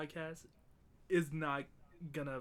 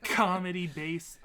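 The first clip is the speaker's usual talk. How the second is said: air-quote sarcasm; 3 semitones higher, 8 dB louder, slower than usual. Pretend. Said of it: "I did air quotes".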